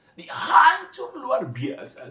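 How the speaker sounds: crying out "hancur" slowly and high-pitched; voice breaking. Real, not acted. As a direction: sob the word "hancur" out. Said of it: "Nggak kuat, saudara". ("hancur" diucapkan menangis, pelan dan tinggi)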